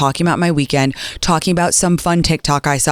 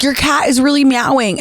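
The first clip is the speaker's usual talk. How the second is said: lightly voiced flight attendant, higher pitch; hint of a concerned hostess, pitched up; imitated air hostess; 8 semitones higher than usual. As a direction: raise the pitch to mimic the complaint. Imitating air hostess waking her.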